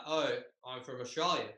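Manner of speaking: casual fake Australian accent; mock Australian student's reply. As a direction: claim it in a put-on Australian accent. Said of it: "That's an interesting accent".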